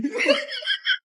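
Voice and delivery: high, breathy giggle. Acted. Laugh at the end of the scene. (ऊँची खिलखिलाहट)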